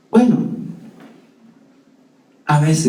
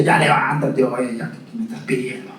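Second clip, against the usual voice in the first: impatient God; 2 semitones lower than usual. Pretend. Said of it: "le hubiera dicho".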